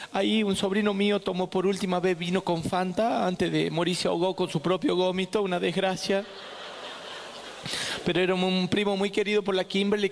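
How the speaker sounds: lightly tearful put-on voice; mock choked-up emotion. Mother recalling the dead cousin, mildly hammed-up grief. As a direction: keep up a mild show of being moved. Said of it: "disculpen que me emociono".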